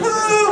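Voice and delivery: high, comic ghostly wail; mock spooky wail; imitated ghost noise. Ghost posing behind siblings in photo, silly wail. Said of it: "making the weird totem pole at the end".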